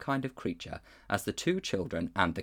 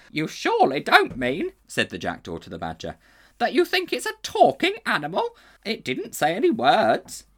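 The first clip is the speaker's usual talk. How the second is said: very high, loud scoffing jackdaw; 9 semitones higher, 6 dB louder than usual. A narrator's reading. Jackdaw mocking the badger's idea. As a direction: scoff like a cheeky bird.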